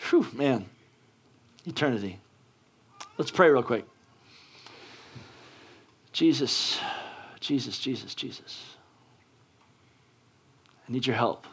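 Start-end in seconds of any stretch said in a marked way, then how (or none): 0.1-2.2 hesitant, with long pauses and an exhale; real jitters, trailing down softer; nervous. Real, not acted. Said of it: "where I get super nervous". 6.1-11.5 soft, low plea with long pauses; quiet, heartfelt appeal for help; hushed prayer. Real, not acted.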